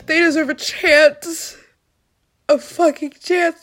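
real raw plea; pleading fierce. Real, not acted. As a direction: insist through tears. Emotional vow.

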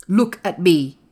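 loud, firm command; imperious boy's order. A narrator's reading. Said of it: "he commanded".

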